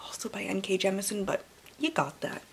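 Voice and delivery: whispered aside to the viewer. Real, not acted. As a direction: drop to a whisper. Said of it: "aside whisper".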